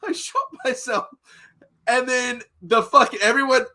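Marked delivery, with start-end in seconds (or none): 0.1-1.1 high, soft mock-British voice; British accent. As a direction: mimic a Brit in a small, high voice. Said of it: "I'm gonna do a British accent".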